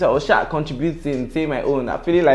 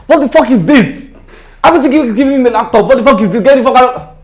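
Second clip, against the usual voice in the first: high, loud enraged yelling; imitating a boss screaming in fury; furious boss shouting; 13 semitones higher, 12 dB louder than usual. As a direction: blast him like a raging boss. Boss yells at him.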